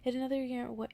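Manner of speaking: ordinary delivery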